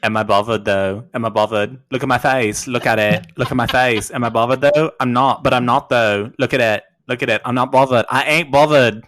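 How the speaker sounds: put-on loud, cheeky teenage taunt, daring them to look at her face; Catherine Tate impression. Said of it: "Catherine Tate show, anyone?".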